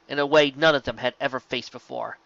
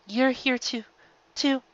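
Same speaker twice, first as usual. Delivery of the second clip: struggling to speak; 11 semitones higher, slower than usual. A narrator's reading. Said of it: "struggling to speak".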